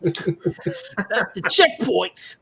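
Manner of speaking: loud, hammed-up Australian TV narrator; bad Australian accent. Mocking a border security TV show, bad Australian accent. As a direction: do a comically bad Australian documentary voice-over. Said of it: "Today on Accents Done Poorly".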